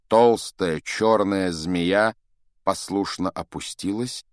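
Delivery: ordinary delivery, nothing marked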